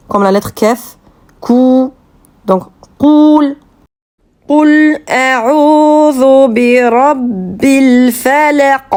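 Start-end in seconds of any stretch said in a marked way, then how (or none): 1.4-3.6 "Qoul" avec un Q profond, pas un K de "Kou"; "Qu" du fond de la gorge. Shown as a demonstration. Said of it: "faut bien prononcer la lettre Qu et pas dire Kou". 4.5-8.9 récitation psalmodiée, lente et tenue; réciter le verset en tajwid, voyelles allongées; récitation coranique. Shown as a demonstration.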